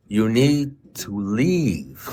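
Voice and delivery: very slow, drawn-out words; retold slow, emphatic instruction; deliberate advice. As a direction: stretch each word as if spelling it out. Spelling out advice to friend.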